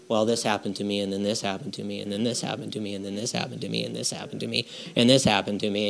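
low, quiet monotone list; droning recital. Acted. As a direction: rattle off complaints in a tedious drone. Acts out reciting the day's facts.

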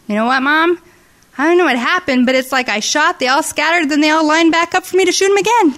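put-on building excited kid voice; excited retelling. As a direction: tell it bursting with excitement. Son's excited story retold.

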